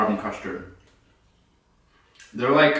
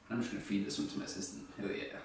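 quiet murmur; 2 semitones lower, 18 dB quieter, faster than usual. Real, not acted. Feeding candy to assistant.

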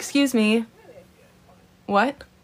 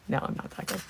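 soft whisper, much quieter; 12 dB quieter, faster than usual. Real, not acted. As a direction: brush off the compliment under your breath. Modest whispered denial.

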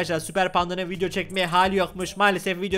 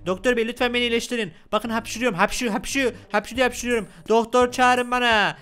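higher-pitched mock patient plea; sick Panda pleading; 4 semitones higher than usual. Sick Panda demands a doctor at reception, mock pleading. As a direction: beg for help, drawing out the last call.

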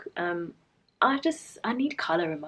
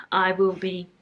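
normal talking voice

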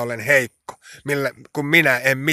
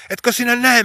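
high, loud pleading to God; distressed cry; 12 semitones higher, 5 dB louder than usual. Retold or imitated. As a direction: cry out in urgent prayer, pitched high. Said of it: "täällä hädissäni ja peloissani". (hätääntynyt, korkea ja kova rukoushuuto)

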